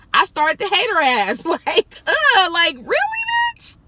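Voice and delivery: disgusted, incredulous rant, pitch shooting up; exasperated venting. Retold or imitated. Driver venting about starting to hate the lady, exasperated high outburst.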